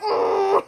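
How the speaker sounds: high, drawn-out "ooh" of dread; mock dismayed wail. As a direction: wail a guilty ooh, played up. Retold dread at being caught.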